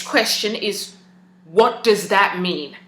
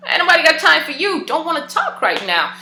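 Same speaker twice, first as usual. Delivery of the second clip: high, sassy dismissive friend voice; sassy brush-off; 8 semitones higher than usual. Retold or imitated. An annoyed friend brushing the speaker off, high and sassy. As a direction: toss it off like you can't be bothered. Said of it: "Homegirl's just like".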